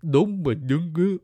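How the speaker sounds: badly faked Vietnamese accent, hammed up; mock Vietnamese accent. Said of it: "this is more, uh, Vietnamese".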